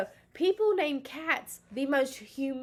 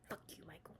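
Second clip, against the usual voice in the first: unvoiced muttered whisper; 4 semitones lower, 20 dB quieter, faster than usual. Real, not acted. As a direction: whisper the curse under breath. Muttered curse at ex.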